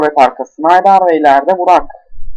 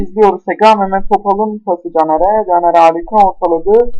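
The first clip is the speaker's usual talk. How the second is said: ordinary talk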